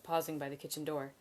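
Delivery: ordinary delivery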